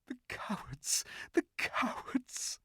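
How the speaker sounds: high whispered sobbing. A narrator's reading. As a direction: sob the words under breath. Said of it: "sobbed Susan".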